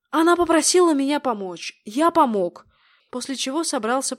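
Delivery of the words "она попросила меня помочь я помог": loud retort. Acted. Brother answers back loudly.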